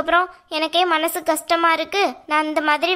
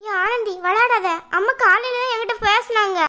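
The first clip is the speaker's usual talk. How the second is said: shocked disbelief; 6 semitones higher than usual. Acted. Refuses to believe bad news.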